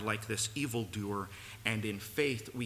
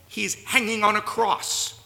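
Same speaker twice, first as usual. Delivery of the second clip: much higher, much louder full-force preaching; impassioned pulpit shout; 10 semitones higher, 11 dB louder than usual. Real, not acted. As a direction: cry it out to the whole room. Preacher cries out the crucifixion.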